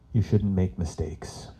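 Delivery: deadpan, slow motherly rebuke; stern mom. Retold or imitated. Mom scolding son about liquid paper, deadpan serious. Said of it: "very serious".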